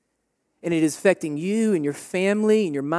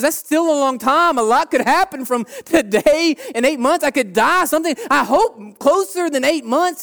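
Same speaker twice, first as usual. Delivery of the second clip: higher, louder imitation of a hesitant young girl; 9 semitones higher, 6 dB louder than usual. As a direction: play the girl bargaining for more time. Preacher voices young girl in story.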